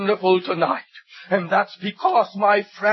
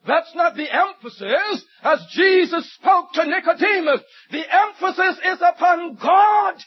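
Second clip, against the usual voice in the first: higher, emphatic pulpit delivery; impassioned preaching; 6 semitones higher than usual. Real, not acted. Preacher correcting where the verse's emphasis falls, impassioned preaching. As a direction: drive the point home like a preacher.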